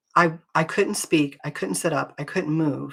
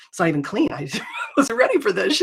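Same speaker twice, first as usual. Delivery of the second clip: embarrassed laugh through the words; laughing, flustered; 3 semitones higher than usual. Retold or imitated. To worship team coming over, laughing and flustered. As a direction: laugh it off, caught with a messy house. Flustered when friends insist visiting.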